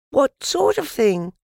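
put-on high, loud child's question; curious child. A narrator's reading. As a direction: pitch it well up, calling to the bear. Voices the child in story.

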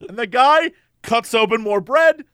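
usual speaking voice

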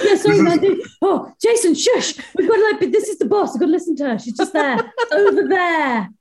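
high, giggly mock apology; laughing. Real, not acted. Guest hushing the other guest before the boss, laughing. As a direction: talk through the giggles, pitched well up.

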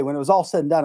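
normal talking voice

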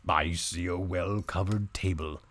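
slow, low donkey voice. Acted. Donkey reporting from the window, slow and low. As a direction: answer slowly as a heavy old donkey. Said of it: "replied the donkey".

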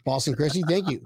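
ordinary delivery

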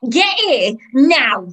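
loud, high, impatient command; yelling. Acted. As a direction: yell an order down a train carriage. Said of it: "yelling down the carriage aisle".

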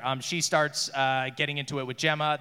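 ordinary talk

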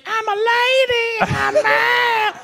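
squeaky high lady voice; high pitched. Retold or imitated. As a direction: pitch it way up, slow. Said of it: "Was it, like, high pitched".

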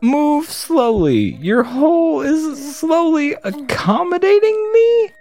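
hammed-up squeaky rodent king; shrill rat king. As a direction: screech at the rat like an outraged king. Rat King's line.

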